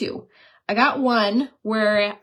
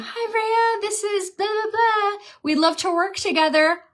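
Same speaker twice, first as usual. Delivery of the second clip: much higher, sugary mock of a cheerful rep; fake-sweet brand rep; 11 semitones higher than usual. Influencer reading the brand's new offer aloud, high sugary mock voice. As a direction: put on a sing-song, too-nice email voice.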